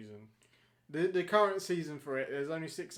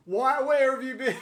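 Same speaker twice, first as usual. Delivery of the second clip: much higher, louder, laughing question; 9 semitones higher, 11 dB louder than usual. Real, not acted. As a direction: laugh it out in disbelief.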